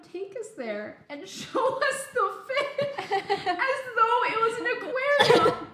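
high, laughing through the words; giggling hard while telling it. Real, not acted. Laughing while telling dad story.

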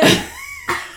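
squealing laugh; high shriek of laughter. Real, not acted. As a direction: burst out laughing. Laughing at cohost choking.

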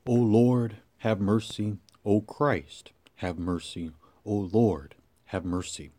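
low, softened liturgical prayer; hushed, low litany in short phrases; solemn prayer. Real, not acted. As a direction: pray softly, voice dropping at each response. Leads prayer after the reading.

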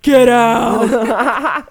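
full-volume drawn-out yell; loud mock-outraged holler; retold furious shout. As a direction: bellow it as the story's punchline. Climax of retold argument.

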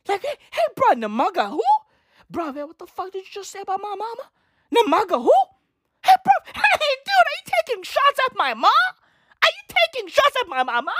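high, fast, indignant hey bro; mock offended fighter. The fighter hearing his name mangled, indignant. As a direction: play the insulted guy defending his mama.

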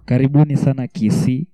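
ordinary delivery

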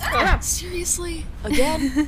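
high loud exasperation; exasperated disbelief. Acted. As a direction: throw up your hands at it. Exasperated at repeat event.

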